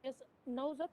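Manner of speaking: faint voice; barely audible. Real, not acted. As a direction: voice too low to hear. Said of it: "No, very low".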